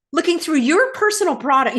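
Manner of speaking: high, indignant outburst, hitting YOUR; real upset, voice climbing. Real, not acted. Woman recalling a search of her bag, upset. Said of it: "Yes, I'm getting upset".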